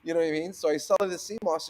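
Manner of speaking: normal talking voice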